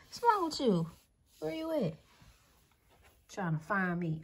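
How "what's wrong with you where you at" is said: light pet talk. Real, not acted. Owner gently teasing her confused pet, lightly high.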